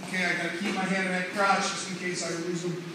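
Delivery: ordinary talk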